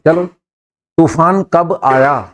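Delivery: ordinary talk, nothing marked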